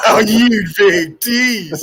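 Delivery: laughing through the words, slow and high. Real, not acted. Laughing at his friend for teasing the viewers. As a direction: giggle it out, drawn out and amused.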